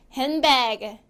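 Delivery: 핸드백 as "hen-bag", no D; D-dropped English pronunciation. Shown as a demonstration. Teacher models after dropping D, English pronunciation. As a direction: say 핸드백 in two English beats without "deu". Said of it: "D가 탈락이 됩니다".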